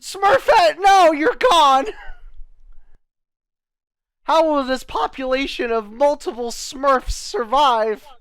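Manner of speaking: high, loud melodramatic sobbing wail; hammed-up wailing. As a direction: wail it like a soap-opera tragedy. Mock grief over a ruined Smurf.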